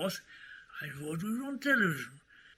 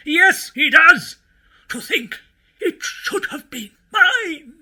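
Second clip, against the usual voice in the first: loud, high indignant outburst; outraged rant; 6 semitones higher, 9 dB louder than usual. Acted. Host learning a rival got the family TV show, outraged rant. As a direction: build a loud rant to an aggrieved cry.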